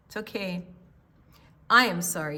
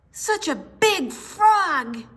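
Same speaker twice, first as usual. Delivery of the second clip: higher, slow, awed frog; 9 semitones higher, 5 dB louder, slower than usual. Acted. Grandma reads the frogs gawping at the dragon, higher and slow. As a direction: stretch it out like a wide-eyed frog. Frogs voiced in picture book.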